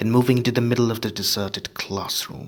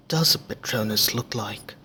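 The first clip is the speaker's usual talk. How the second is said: usual speaking voice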